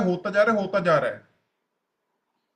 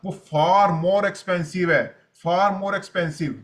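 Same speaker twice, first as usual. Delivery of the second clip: each word of far more expensive drawn out very slowly; slow emphatic; much slower than usual. Acted. Stressing the cost of poor health.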